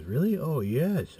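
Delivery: high, lilting surprised reply; surprised delight. Retold or imitated. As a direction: play her pleasantly surprised. Voices the woman's surprised reply.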